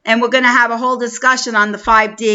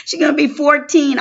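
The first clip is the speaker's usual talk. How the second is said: ordinary delivery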